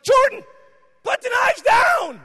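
very high, loud shout, drawn out slowly; exploding dad. Retold or imitated. Father to son as diners leave, exploding shout. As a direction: hammer out a furious parental order. Father's anger peaks in story.

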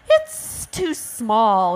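slow, drawn-out hissing refusal; storybook ostrich hiss. Acted. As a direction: stretch it out with a hiss. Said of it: "hissed the ostrich".

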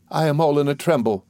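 a touch of anxious faltering. A narrator's reading. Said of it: "faltered my mother".

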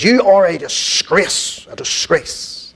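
slow, drawn-out mock outrage; imitated indignant accusation. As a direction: act out the caller's scolding, word by word. Retold angry caller's accusation.

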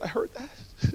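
a hushed whisper, leaning in; mock whisper to the old lady; whispered reply. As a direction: whisper it back conspiratorially. Reply after leaning forward.

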